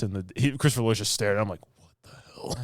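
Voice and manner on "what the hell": whispered disbelief. Retold or imitated. Speaker's own reaction retold.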